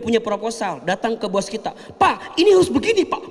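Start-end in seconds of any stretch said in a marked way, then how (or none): 1.9-3.3 high, loud insistent plea to the boss; nagging the boss hard; pushy demand. Retold or imitated. Wife barging in on the boss, loud and pushy. Woman pushing boss impatiently.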